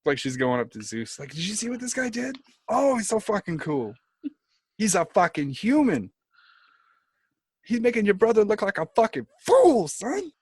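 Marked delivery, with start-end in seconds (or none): 1.3-10.3 high-pitched hammed-up excited goddess; gush, building to a loud peak on "fool"; Athena gushing. Athena reporting to Zeus on Odysseus, gushing excitedly.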